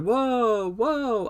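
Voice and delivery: high drawn-out whoa, imitating the group; shocked whoa. A host imitating contestants gasping in shock. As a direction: echo the room's gasp of shock. Everyone reacting to shut up.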